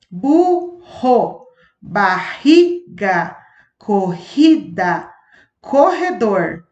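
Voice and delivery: each word read out clearly, its rr a strong throaty h-like sound; strong rr word list. Shown as a demonstration. Teacher reading examples of the strong rr to the class. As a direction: read the rr words out deliberately, one by one. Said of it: "Vejamos outros exemplos".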